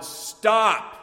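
loud, high, sudden shout; shouted command. Acted. Preacher imagining God halting raging nations, shouted command. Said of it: "God would shout aloud".

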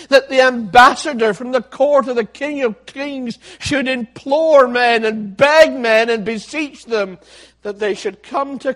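loud, impassioned pulpit preaching; fiery preaching. Acted. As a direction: preach it out loud, pleading with sinners. Preaching at full force.

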